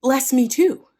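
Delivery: high, loud mock-tearful cry; hammed-up bitter plea. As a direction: burst out begging through pretend tears. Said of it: "he burst out in tears bitterly".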